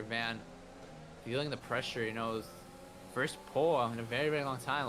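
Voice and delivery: ordinary talk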